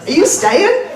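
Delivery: high and loud Southern mimic, laughing; southern accent. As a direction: mimic the gate lady again, amused. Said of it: "running joke".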